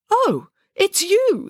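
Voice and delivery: high, slow, surprised woman's voice; a startled older woman, drawn out and high; gunwoman. A narrator's reading. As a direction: unmask the threat, suddenly familiar. Masked gunwoman speaks.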